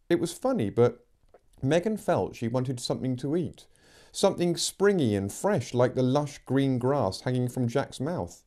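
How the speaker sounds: ordinary delivery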